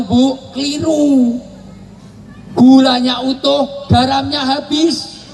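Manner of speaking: high, slow punchline reveal; slow and high, savouring the joke; dramatic reveal. Real, not acted. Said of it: "punchline reveal".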